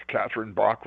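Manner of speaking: Bach said as BOCK, open ah; Bach with ah vowel. Shown as a demonstration. Showing the other way to say the name, BOCK. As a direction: Catherine Bach like the composer Bach. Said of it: "You can say Bach or Bach".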